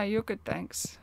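ordinary delivery

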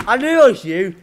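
loud, high accusation; accusing shout. Real, not acted. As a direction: call him out loudly. Catches friend trolling.

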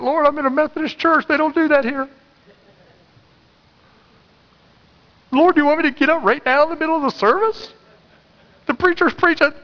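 very high-pitched, squeaky protest; arguing with God. Retold or imitated. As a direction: plead your excuse in a comic high voice. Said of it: "arguing with God".

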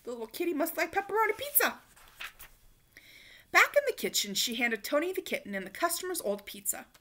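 usual speaking voice

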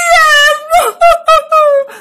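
high loud comic wail; hammed-up blubbering sea monster. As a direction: howl like a crying monster. Monster bursts into tears.

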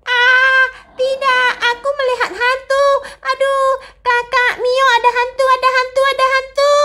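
high panicked calls for help; child crying out that there's a ghost, panicked and high; frightened cry. Acted. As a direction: scream for your sibling, terrified. Mia sees ghost, calls sibling.

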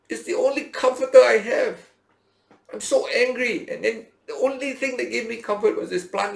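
high, loud, indignant shouting; furious complaint. Acted. Jonah shouting at God over the lost plant, furious. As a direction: hurl the accusation at God.

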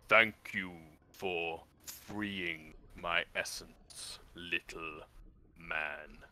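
slow, low crab creature rumble; deep rumbling voice. Acted. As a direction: rumble it out slowly like an ancient beast. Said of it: "you hear a deep rumbling voice".

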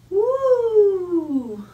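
very high, long falling whoop; sustained celebratory whoop; playful cheer. Pretend. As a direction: let out a high woo for toddlers. Celebrating after the second count.

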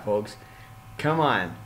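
usual speaking voice

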